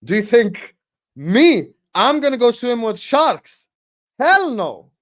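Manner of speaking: telling people inviting him to swim with sharks, hammed-up refusal; mock indignation. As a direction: refuse like it's absurd. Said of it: "I'm like".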